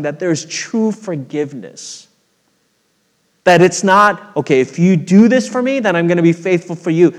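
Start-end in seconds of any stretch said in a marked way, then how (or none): 3.4-7.1 loud, high preaching climb; preaching at full force. Acted. Preacher pressing the point to the congregation, loud and high. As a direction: drive it home from the pulpit.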